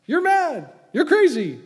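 high, loud yap; barked. Acted. Festus barking at Paul, loud and high. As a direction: snap it out like a barking dog. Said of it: "He barked".

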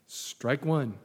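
ordinary delivery

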